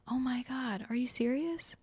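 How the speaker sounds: soft, high, rising disbelief; retold amazement. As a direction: act out his hushed astonishment. Boyfriend reacting to love confession, retold.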